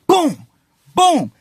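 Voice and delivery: loud, high-pitched punch sound effect; short explosive burst for each blow; imitated punch impact. Acts out punches in the fight.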